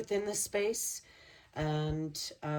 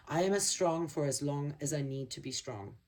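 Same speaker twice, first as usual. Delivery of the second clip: usual speaking voice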